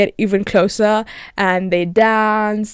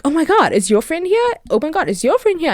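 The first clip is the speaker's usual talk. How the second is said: high, gushing mock excitement, a bit faster at the end; excited squeal; 8 semitones higher than usual. Friends eagerly asking about the mystery girl, high giddy excitement. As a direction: gush like giddy matchmakers.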